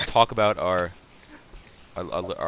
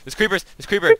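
high, loud panicked warning; alarmed shout; 12 semitones higher, 8 dB louder than usual. Real, not acted. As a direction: yell a warning, creepers are coming. Creepers attacking in game.

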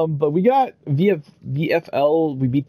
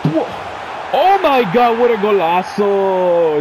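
high, loud burst of amazement; amazed goal reaction; 4 semitones higher, 3 dB louder, slower than usual. Real, not acted. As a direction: burst out loud, then stretch the last word.

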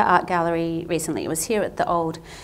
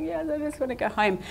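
higher, teary voice close to crying; tearful reply; 9 semitones higher than usual. Retold or imitated. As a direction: answer through tears, wanting only home. Crying on plane, answering stranger.

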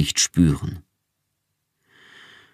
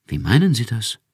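puzzled; 6 semitones higher than usual. A narrator's reading. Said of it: "Rachel klang verdutzt".

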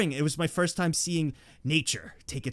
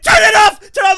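frantic yell, much higher and louder; mom yelling; 18 dB louder than usual. Retold or imitated. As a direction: scream it at the kid. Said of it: "she's like".

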